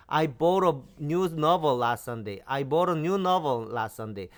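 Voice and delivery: very slow, careful English; teacher reads the English sentence slowly for students; slow model reading. Shown as a demonstration. Each word drawn out. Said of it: "영어로 한번 해보겠습니다".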